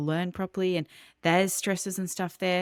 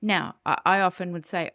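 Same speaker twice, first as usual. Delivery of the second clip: ordinary delivery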